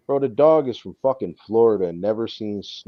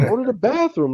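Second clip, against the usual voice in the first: high, louder call to the dog; mock yell at dog; 6 dB louder than usual. Owner pleading with his new dog in the cold, mock yelling. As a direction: urge the dog outside with a pitched-up shout.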